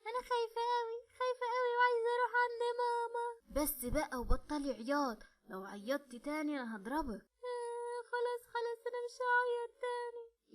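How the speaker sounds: put-on a scared child begging for mama; frightened little girl. (بنت صغيرة خايفة بتعيط وعايزة مامتها بصوت عالي)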